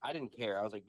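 ordinary talk, nothing marked

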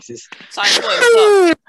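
high, loud hype call; shouting a hyped welcome; excited greeting. Real, not acted. Hyped greeting to returning friend.

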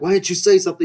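high, loud mock outrage; exasperated reproach. Diner blames friend for silence, exasperated reproach. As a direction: demand an answer, voice shooting up. Acted quarrel over mistaken friend.

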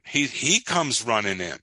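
ordinary talk, nothing marked

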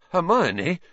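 high, questioning, feeble call; weak protest. A narrator's reading. Ron trying to restrain Hermione, weakly. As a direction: call her name weakly, pitch rising. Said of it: "said Ron weakly".

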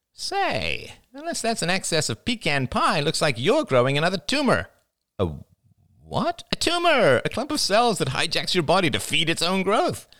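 very high, nasal goading; Jada, gleefully provoking. A narrator's reading. Jada taunting pregnant Diane, high mocking voice. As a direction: provoke her in a high, showy voice.

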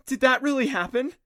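high, loud astonishment; girl staring at the hole in disbelief; stunned disbelief. Acted. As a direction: gasp it out in shock. Wide-eyed after the trapdoor.

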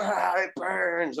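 slow, high, pained wail of a burning demon; mock pained cry. Demon in the rain crying out to Craig, slow pained wail. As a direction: moan as if the rain burns you. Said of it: "he was like".

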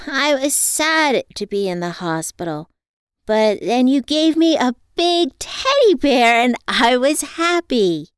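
put-on high, loud child voice; little girl. A narrator's reading. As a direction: tell it like a happy small girl.

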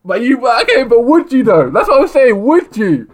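high, loud, incredulous; excited disbelief. Real, not acted. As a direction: push back in amused disbelief. Laughing disbelief at friend.

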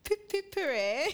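a silly posh British hooray; mock British. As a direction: cheer like a parody English gent. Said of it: "we're an insult to the British people".

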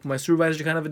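fast, loud shouted guard impression; hammed-up guard, rushing it out; security guard voice.

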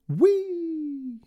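high, loud gleeful whee. A narrator's reading. Small kite leaping out the window, high gleeful whee. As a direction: shout it with pure joy. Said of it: "shouted with glee".

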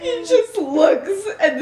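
slow, high, laughing through the words; can barely get it out for laughing; real helpless laughter. Real, not acted. Laughing mid-story.

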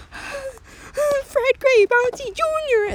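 very slow, high horrified wail of the name; terrified gasp. Acted. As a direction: stretch the name out in horror. Terrified lady names villain.

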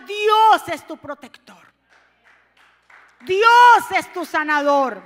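slow, loud, stress on Dios; fervent preacher's affirmation; preaching declaration. Acted. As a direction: declare it from the pulpit.

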